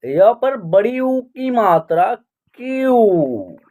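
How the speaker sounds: "क्यू" stretched on its vowel, falling; pronunciation demo. Shown as a demonstration. Teacher building the letter's sound for learners, "क्यू" drawn out. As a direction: spell it out slowly, ending on a long "क्यू". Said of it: "तो इसका उच्चारण करेंगे".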